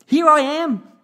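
very high, loud shout; excited shout. A narrator's reading. Child jumps out at granddad, excited shout. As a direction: pop out and shout it at full voice. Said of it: "pop out and shout".

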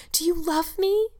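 high, pleading question, as read aloud; Anne crying out. A narrator's reading. Anne asks Diana if she is loved, crying out. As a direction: ask it high, rising in hope. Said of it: "cried Anne, clasping her hands".

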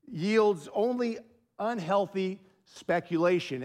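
usual speaking voice

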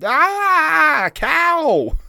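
high, loud yelp of Ah cow; cartoon cry of alarm at a cow; mock panicked yell. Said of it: "Khan going".

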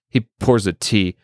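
plain reading voice

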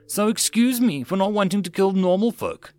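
high-pitched, sarcastic protest; indignant retort. Acted. Mills defending his refusal to kill, indignant. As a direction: throw it back indignantly.

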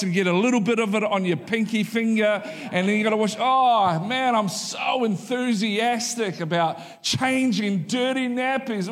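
sarcastic cheerfulness, playing the thrilled parent; mock enthusiasm. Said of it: "None of us enthusiastically go".

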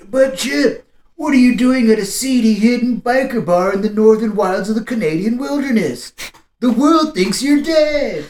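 put-on high, whiny teenager; high teen voice. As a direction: ask it like a clueless kid. Teen questions Chip.

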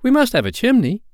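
put-on very high, loud boyish demand; high-pitched, commanding little boy; Peter's voice. A narrator's reading. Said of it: "Peter said".

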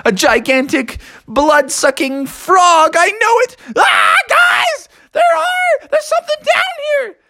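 very high squealing alarm; panicked frog. Acted. Froggy warns the guys of something down here, panicking. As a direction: build into a hammed-up terrified cry.

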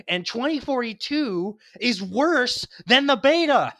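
loud, high, slowed for emphasis; heated insistence. Real, not acted. Heated argument about game beta.

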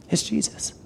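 breathy whisper, dropping to almost nothing; whispered reveal. Acted. Pastor revealing the answer to the congregation, whispered. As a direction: hush it like a secret.

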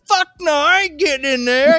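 imitated high, hollering outburst; loud refusal. Husband refusing to join the technician, high loud holler.

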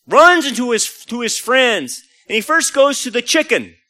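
ordinary talk, nothing marked

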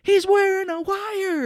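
high, loud, drawn-out accusation; mock alarmed yell. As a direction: raise the alarm, hammed-up. Retold discovery of a wire.

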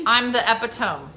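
"epitome" misread as EP-ih-tome, rhyming with home; mispronounced epitome. Retold or imitated. Daughter misreading her edited essay, "epitome" mispronounced as EP-ih-tome. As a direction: say "epitome" in three syllables, ending like tome. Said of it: "First of all, it's not epitome".

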